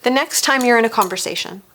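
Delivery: usual speaking voice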